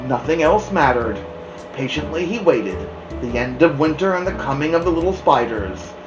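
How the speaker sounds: ordinary delivery, nothing marked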